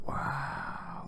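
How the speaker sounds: hushed, breathed-out imitation of awe; awed kid. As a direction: whisper it like an amazed child. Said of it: "He's all excited".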